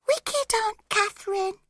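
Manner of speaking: put-on high little child's voice; a baby whimpering the words; sobbing child. A narrator's reading. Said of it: "sobbing and saying".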